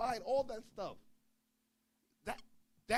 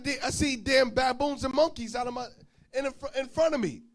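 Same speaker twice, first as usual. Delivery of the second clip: excited shock; 7 semitones higher, 7 dB louder than usual. Retold or imitated. Shocked at baboons outside room.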